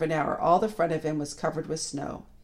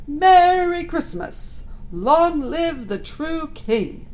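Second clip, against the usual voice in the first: slow, high, loud joyful call; jolly cry; 12 semitones higher, 8 dB louder, much slower than usual. A narrator's reading. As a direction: cry it out like a jolly old man. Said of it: "Then he cried out".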